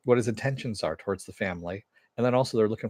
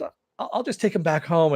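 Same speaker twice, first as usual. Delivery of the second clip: higher, eager offer; Superion offering; 8 semitones higher, 4 dB louder than usual. Retold or imitated. Host voicing Superion offering to take Pearl home, higher. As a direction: pitch up and volunteer.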